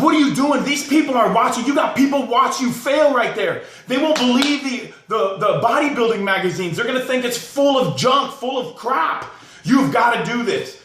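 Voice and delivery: Arnold yelling. Retold or imitated. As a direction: yell at him like a drill coach pushing a lifter. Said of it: "screams at him".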